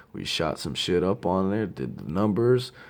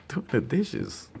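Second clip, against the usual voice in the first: slow, high matter-of-fact reply; drawn out and pitched up; lightly voiced friend at the sink; imitating friend; 10 semitones higher, slower than usual.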